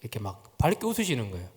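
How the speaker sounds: ordinary talk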